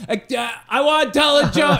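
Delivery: put-on high, loud pushy kid voice; a kid shouting to get the mic; eager kid. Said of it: "Sure enough, he's like".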